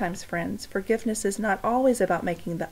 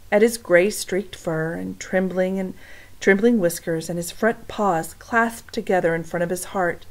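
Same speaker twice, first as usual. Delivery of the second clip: ordinary delivery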